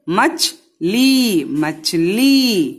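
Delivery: मछली said very slowly with a long final ई; slow model word. Shown as a demonstration. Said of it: "ला पे बड़ी".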